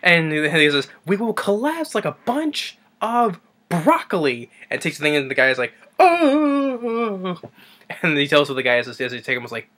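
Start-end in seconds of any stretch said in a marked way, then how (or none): 1.0-4.5 high, slow, drawn-out proclamation; acted lecturer. Professor demonstrating on a patient, high slow proclamation. 6.0-7.4 long high "ohhh"; high pained moan. Retold or imitated. The guy reacting to the demonstration, high drawn-out moan.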